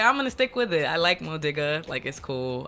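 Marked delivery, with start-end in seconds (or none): 0.0-0.9 loud, high, enthused resolve; excited quoted decision, lifted up; happy decision. Retold or imitated. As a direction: declare it brightly with raised voice. Acting own happy decision.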